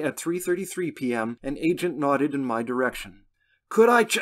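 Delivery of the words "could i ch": high, loud strangled attempt; choked-off start. A narrator's reading. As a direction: break off as the throat catches. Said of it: "tightness in my clenched throat forced me to stifle a cough".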